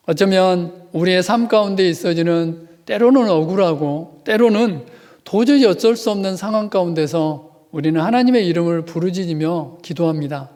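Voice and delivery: ordinary talk, nothing marked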